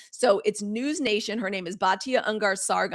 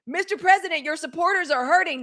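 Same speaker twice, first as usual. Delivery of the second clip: higher, lightly imitating an earnest plea; mock pleading pundit; 7 semitones higher, 5 dB louder than usual. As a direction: play her earnest appeal, a bit mocking. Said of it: "saying, I quote".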